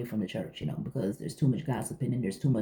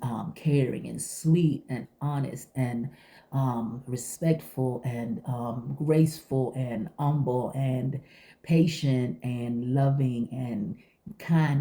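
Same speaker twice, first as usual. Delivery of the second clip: slow, lingering list of virtues; slow savouring list; slower than usual. Real, not acted. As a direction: take your time on each word.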